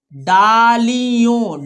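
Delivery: डालियों très étiré, syllabe par syllabe; lecture syllabée. Shown as a demonstration. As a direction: tiens chaque syllabe de डालियों. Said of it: "हमारे साथ पढ़ना है आपको".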